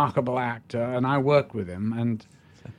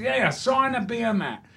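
hammed-up Cockney pub heckler, pitched way up; Cockney fan; 10 semitones higher than usual. Cockney stranger barging into the pub snug, high hammed-up Cockney. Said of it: "He goes".

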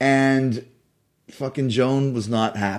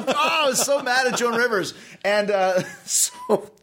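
ordinary delivery

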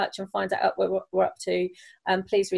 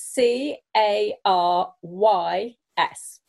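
slow letter-by-letter spelling; 3 dB louder, much slower than usual. Real, not acted. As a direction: spell it slowly, one letter at a time. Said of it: "Can you just spell that".

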